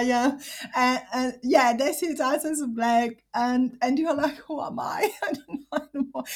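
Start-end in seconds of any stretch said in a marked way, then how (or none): 4.5-6.2 choked, quick murmur of confusion; tearful. Real, not acted. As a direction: speak through near tears. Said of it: "You are exhausted".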